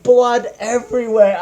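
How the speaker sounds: ordinary delivery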